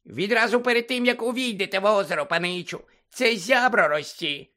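Dobby the house-elf squeaking at Harry; squeaky house-elf. Acted. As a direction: squeak it like an anxious little elf.

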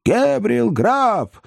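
very high, drawn-out screech; shrieking wild chorus. A narrator's reading. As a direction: squeal the name like a crowd of spirits. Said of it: "взвизгнул дикий хор голосов".

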